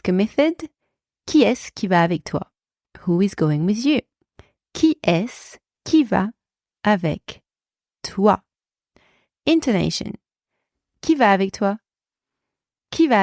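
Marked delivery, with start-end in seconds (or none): 4.7-8.4 chunked, with pauses between. Shown as a demonstration. Teacher breaks down model phrase.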